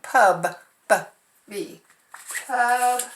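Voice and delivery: Pub with the b sound stretched and repeated; teacher stressing the missed B sound. Shown as a demonstration. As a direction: isolate the b in Pub. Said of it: "Oops, I forgot the B sound".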